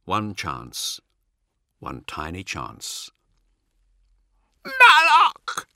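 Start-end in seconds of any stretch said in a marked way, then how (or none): 4.7-5.7 very high, loud squeal. A narrator's reading. Possessed Cat with eyes rolling back, squealing. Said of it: "Cat squeals".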